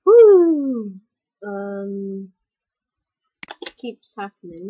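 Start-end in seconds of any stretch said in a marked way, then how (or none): 0.1-0.9 loud high celebratory woo. Real, not acted. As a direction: whoop in triumph. Celebrating in game.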